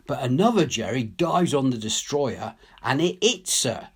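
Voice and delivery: plain reading voice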